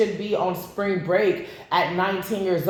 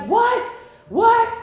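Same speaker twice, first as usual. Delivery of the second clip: much higher, louder double shout of shock; shocked disbelief; 14 semitones higher, 6 dB louder than usual. Retold or imitated. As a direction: yelp it out in stunned disbelief. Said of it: "And he was like".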